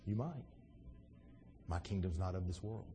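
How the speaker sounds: soft, low, unruffled reply; talking real low. Acted. Jesus answering Pilate's death threat, talking real low. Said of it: "talking real low".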